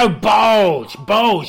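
high, loud shouted balls; mock shouted oath. [name] shouting balls at his friend over Zoom. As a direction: bark it out in exasperation.

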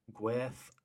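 "Gwerth" said softly as one syllable, "gweth"; chosen Welsh pronunciation. Shown as a demonstration. Film fan attempting the Welsh title, careful soft pronunciation. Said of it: "is how I'm choosing to say it".

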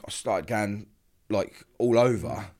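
ordinary talk

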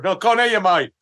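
angry comic. Acted. Comic on stage answering the crowd, mock angry. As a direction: snap back at a heckler, hammed-up. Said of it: "really angry on stage".

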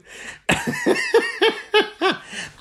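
long very high burst of laughter. Real, not acted. Host cracking up at his flirting joke. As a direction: crack up properly.

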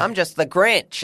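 exaggerated easygoing American accent. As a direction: imitate an ordinary American guy, drawn out. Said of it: "it's a decent American accent".

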